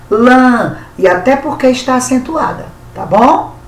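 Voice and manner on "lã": "lã" held long and pitched higher; strong stressed syllable. Shown as a demonstration. Teacher isolating the tonic syllable for pupils, "lã" stressed and lengthened. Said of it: "sílaba forte".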